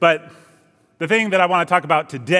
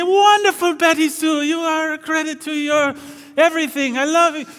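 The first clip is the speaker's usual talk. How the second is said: very high, gushing mock teacher; hammed-up delight at a favourite pupil, far above the usual pitch; Mister Jorgensen; 11 semitones higher than usual. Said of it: "And he'd say".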